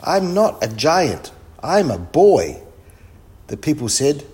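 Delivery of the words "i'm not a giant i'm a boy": put-on loud, high boy's protest; shouted. A narrator's reading. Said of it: "shouted Kipper".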